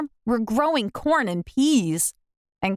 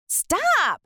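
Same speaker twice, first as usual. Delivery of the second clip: high, sharp stop; sudden high stop; urgent stop; 10 semitones higher than usual. A narrator's reading. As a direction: cry out to stop her. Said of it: "I said".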